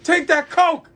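high, loud barked order; aggressive. Retold or imitated. Drive-thru worker orders customer about, aggressive shout. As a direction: bark it like a mock drill sergeant. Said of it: "they're all aggressive".

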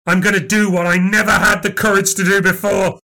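steel yourself to strike; menacing resolve. Acted. Resolving to kill her.

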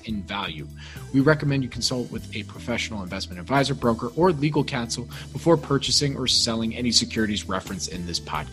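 quiet, quick disclaimer read; fast legal disclaimer. Acted. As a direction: rattle off the fine print softly. Legal disclaimer read under music.

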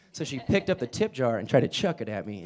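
ordinary talk, nothing marked

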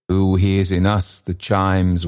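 plain reading voice

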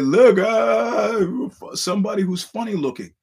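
high, loud mocking voice; mocking own text. To his friend, joking about the photo in a high mock voice. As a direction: squeak it out like a silly joke.

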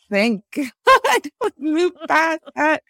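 high, loud, laughing thank God; laughing relief. Retold or imitated. Her birthday thought, acted relief.

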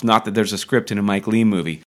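usual speaking voice

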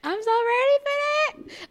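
high, squealing with glee; giddy excitement. Real, not acted. Hyped fan gushing about the new movie, giddy.

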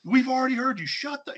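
high-pitched mock yell; imitated heckler. Host re-enacting the voice from the snowball video, imitated heckler. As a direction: play the fed-up stranger cutting someone off. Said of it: "but it was like".

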